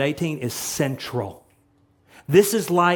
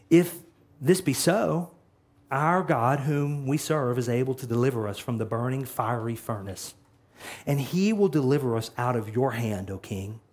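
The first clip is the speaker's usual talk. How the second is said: hushed solemn faith; 5 semitones lower, 5 dB quieter than usual. Shown as a demonstration. Solemn confession of faith read.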